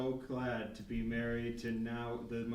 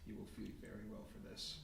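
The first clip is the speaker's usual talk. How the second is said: unvoiced, much softer conspiratorial whisper; creepy whisper; 15 dB quieter, much faster than usual. Character whispering to his pigeons, a hushed creepy promise. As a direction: whisper a secret promise to the birds.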